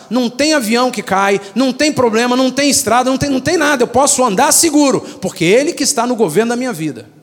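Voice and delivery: ordinary talk, nothing marked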